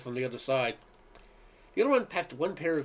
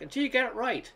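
mock yell back; 9 semitones higher than usual. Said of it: "I yelled back".

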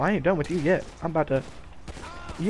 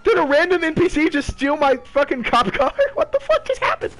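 high, loud disbelieving yell; shocked outrage; 13 semitones higher, 6 dB louder than usual. Real, not acted. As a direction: shout it in stunned disbelief. NPC steals his cop car.

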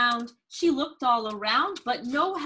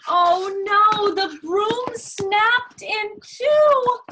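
high, loud storybook alarm; slow, high, loud dismay for a young listener; dramatic alarm; 9 semitones higher, 7 dB louder, slower than usual. A narrator's reading. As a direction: draw out the disaster in a raised voice. Story disaster read to child.

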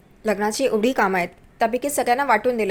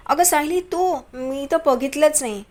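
higher-pitched, drawn-out mock astonishment; feigned surprise; 4 semitones higher than usual. A narrator's reading. Priya pretending she never saw Sayali there, put-on surprise. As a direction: act as if you only just noticed her.

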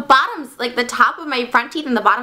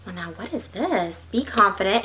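usual speaking voice

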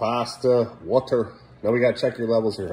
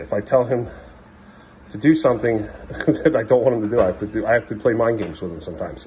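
ordinary delivery

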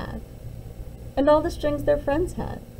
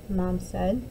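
usual speaking voice

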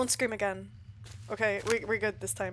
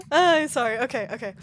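usual speaking voice

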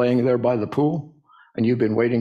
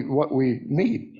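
ordinary talk

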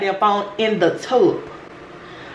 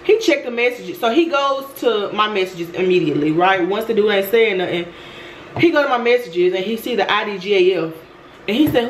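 ordinary delivery

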